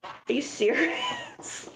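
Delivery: high, slow disbelieving squeal; incredulous. Retold or imitated. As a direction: stretch it out in shock. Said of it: "And I'm like".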